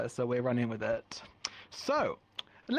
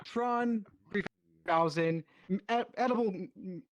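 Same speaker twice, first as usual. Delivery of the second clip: hard sell ad; 6 semitones higher than usual. Acted. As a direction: sell it like a TV salesman. Improv infomercial pitch.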